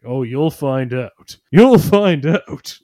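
ominous caveman. Retold or imitated. Paranoid caveman teasing his friend Grog, slowly and ominously. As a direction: drag it out like a knowing prophet, then boom it. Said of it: "a caveman who had that same paranoia".

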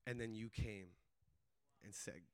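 low voice fading to a near murmur; hushed confession. Retold or imitated. Guard confessing his plans to the student, hushed.

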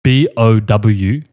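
slow, letter by letter; spelling it out. Shown as a demonstration. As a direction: spell each letter slowly. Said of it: "spell it for me".

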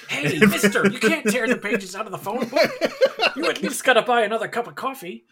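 loud, high scolding clerk; annoyed counter boy. Acted. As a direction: scold him like a diner clerk.

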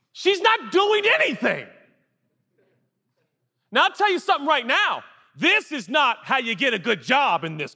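high, strained indignant rant; outraged scolding. Acted. Indignant American lectures the idle sister, mock outrage.

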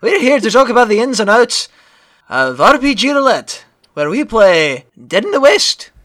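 hearty fake Irish host; very bad Irish accent. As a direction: open briskly in a hammed-up Irish accent. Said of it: "my very bad Irish accent".

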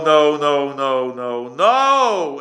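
ordinary delivery

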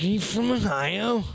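high, bright-eyed mock drunk woman. Drunk woman asking the Ohio State fan, high mock voice. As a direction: pitch it way up like a tipsy bar girl. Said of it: "She's like".

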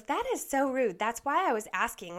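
high, loud, offended protest; indignant retort. Retold or imitated. Delivery driver snaps back at the woman in line, high and loud. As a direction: huff back that she's been insulted.

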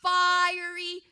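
loud warning building to a boom; emphatic royal threat. Acted. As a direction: punch it home as the threatening king. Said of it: "He's making sure you understand".

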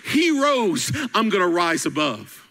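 loud, high, climbing sermon peak; full-force preaching. Real, not acted. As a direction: preach it out, rising to the top. Preaching crescendo, music under.

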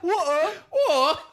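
very high, loud mock-Cockney "water"; exaggerated Cockney echo; mimicked Cockney accent. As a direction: squeal "water" in teasing Cockney, t swallowed. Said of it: "Your accent is the funniest thing".

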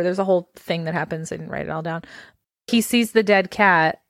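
ordinary delivery

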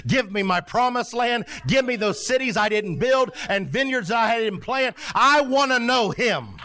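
high, driving pulpit declaration; impassioned preaching. Acted. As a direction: preach it high, stretching the last line. Preaching at full force.

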